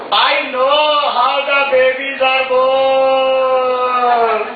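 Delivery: very slow boast, last word hugely drawn out; boastful Indian kid. Acted. Ten-year-old bragging to his cousin in England, boastful. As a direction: stretch BORN out like a proud child's big reveal. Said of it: "ten years old cousin from India".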